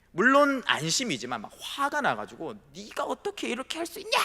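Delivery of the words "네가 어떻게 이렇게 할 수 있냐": mock snapping mother. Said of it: "딱 쏘아붙이니까".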